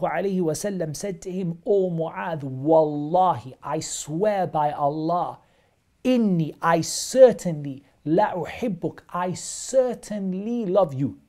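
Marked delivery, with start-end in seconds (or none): 1.7-11.2 slow, loud, weighty oath of love; emphatic oath. Retold or imitated. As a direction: lean hard on each oath, slowly. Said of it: "emphasized it three times".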